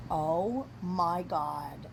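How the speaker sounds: very slow, stretched words; drawn-out disbelief. Retold or imitated. To boyfriend holding the missing keys, slow disbelief. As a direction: drag out each word as the prank sinks in.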